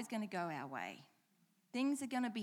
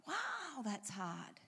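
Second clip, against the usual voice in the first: ordinary delivery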